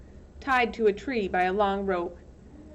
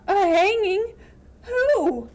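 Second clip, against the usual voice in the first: high alarmed crying gasp; shocked; 11 semitones higher, 7 dB louder, slower than usual. Acted. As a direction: recoil in horror. Shocked at hanging news.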